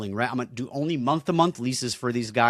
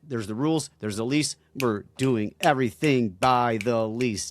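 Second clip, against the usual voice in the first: normal talking voice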